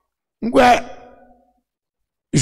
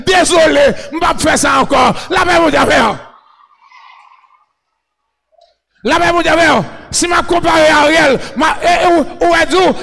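shouted, driving sermon in short bursts; full-force preaching; 4 semitones higher than usual. Real, not acted. Preacher rousing the crowd to cry alléluia, full-force preaching. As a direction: fire each phrase out at the congregation.

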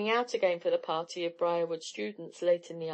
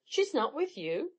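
higher-pitched, dismayed question; a touch of dismay; chagrined exclamation; 7 semitones higher than usual. A narrator's reading. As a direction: ask it in mild disbelief. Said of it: "exclaimed Tom, rather chagrined".

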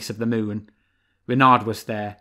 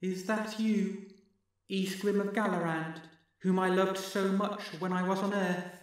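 strange distant voice; 8 semitones higher, 7 dB quieter than usual. Acted. Distant voice from the well.

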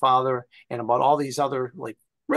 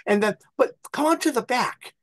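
put-on higher little old lady explaining; chatty old neighbor showing the way; 6 semitones higher than usual. Old lady comparing the two houses, high and chatty. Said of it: "And she's like".

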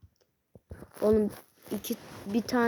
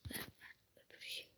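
fast whisper; 24 dB quieter, faster than usual. Real, not acted. Gamer whispering urgently at the game. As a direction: hurry it out without voice.

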